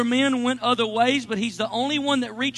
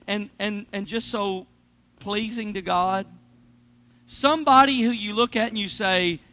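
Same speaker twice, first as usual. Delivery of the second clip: normal talking voice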